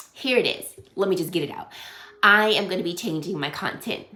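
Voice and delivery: usual speaking voice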